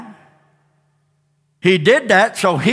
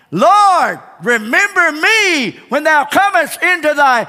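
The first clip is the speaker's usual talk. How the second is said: crying out; 7 semitones higher, slower than usual. Acted. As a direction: cry it up to heaven. Thief's cry to Jesus.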